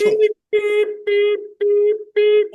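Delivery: high, loud reversing-truck beeps; mock beep beep of a forklift. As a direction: imitate a forklift backing up. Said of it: "I call this forklift".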